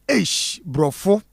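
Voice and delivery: put-on teasing the caller's posh accent, lightly; mock ladies' accent. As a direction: put on a prissy English-speaking lady's voice for "Ish, brofo". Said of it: "Just ladies' accent".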